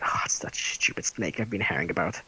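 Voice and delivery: low, soft muttered scorn; quiet contempt for the snake; scornful aside. Acted. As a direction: sneer it under your breath. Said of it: "She looks at Sneck like".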